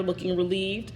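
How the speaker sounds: ordinary delivery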